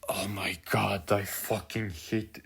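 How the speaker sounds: low, hushed loathing; barely voiced, drained frustration; exasperated whisper. Real, not acted. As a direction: whisper it, utterly fed up. Overcome with loathing for the book.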